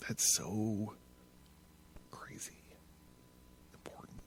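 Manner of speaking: real, voiceless awed whisper fading out; whispered admiration; whispered aside. Real, not acted. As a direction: hush it as if stunned.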